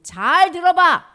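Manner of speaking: slow, loud and deliberate; 천천히 크게 또박또박 경고; stern warning. Acted. 예수님이 서기관을 꾸짖음. (천천히 크게 엄하게 경고)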